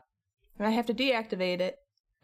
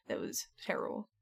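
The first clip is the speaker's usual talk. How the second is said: unvoiced whisper, much softer; 8 dB quieter than usual. Real, not acted. Recalling her vaccine headache, tired whisper. Recounting a three-day headache.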